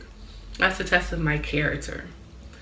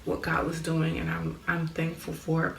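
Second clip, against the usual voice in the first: usual speaking voice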